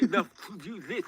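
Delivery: put-on high, spitting lisped accent; spluttering philosopher caricature; Zizek. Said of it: "just spitting everywhere".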